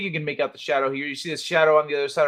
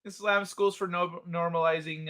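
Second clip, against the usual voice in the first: usual speaking voice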